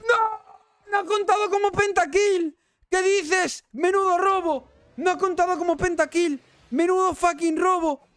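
very high, loud shriek of disbelief; outraged screaming. Real, not acted. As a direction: scream it like you've just been robbed. Outrage at uncounted pentakill.

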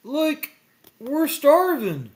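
high, loud, drawn-out wail; comic hungry despair, stretched slow; Shaggy moaning. A narrator's reading. Shaggy starving without his snacks, wailing. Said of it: "Shaggy moaned".

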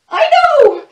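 high, loud eager squeal; excited little monkey. Acted. The little monkey with the winning plan, high eager squeal. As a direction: burst out high and loud with the idea. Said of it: "says one little monkey".

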